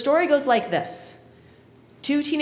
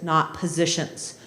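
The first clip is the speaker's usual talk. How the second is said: "not positions" said slowly and firmly, stress on "not"; slow emphatic contrast; 4 semitones lower, slower than usual. Shown as a demonstration. Trainer hammering home the key skill: slow, emphatic. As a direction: land the contrast word by word.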